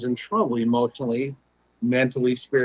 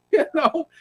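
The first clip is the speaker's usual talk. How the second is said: laughing; 5 dB louder than usual. Real, not acted. Laughs about being hung up on.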